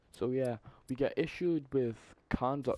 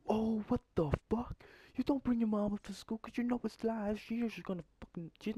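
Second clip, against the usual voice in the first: mock outrage; 11 semitones higher than usual. Retold outrage at friend's idea.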